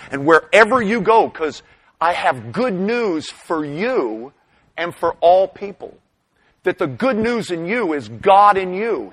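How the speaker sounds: loud, ringing preacher's build over good news; preaching at full force. Acted. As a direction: proclaim it to the whole room.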